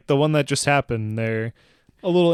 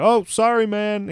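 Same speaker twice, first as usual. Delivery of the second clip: higher, louder mock call over headphones; yelling over music; 8 semitones higher, 3 dB louder than usual. Imitating the milkman yelling over his music. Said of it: "he's yelling over his music".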